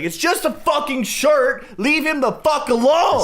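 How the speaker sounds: high, slow, emphatic mock scream; retold furious yelling. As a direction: drag out each angry word at high pitch. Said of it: "they're, like, spitting at each other".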